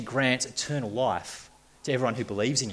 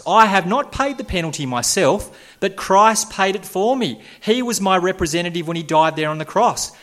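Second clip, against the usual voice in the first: higher and louder, rising to a triumphant "I'm clear"; confident saved man; 8 semitones higher, 6 dB louder than usual. Acted. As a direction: declare your freedom with growing joy. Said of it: "says the man saved by grace".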